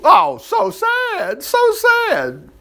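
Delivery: high, drawn-out fake lament; milk it with exaggerated sympathy, very slowly; mock pity. Preacher teases the sick man's excuse, mock pity.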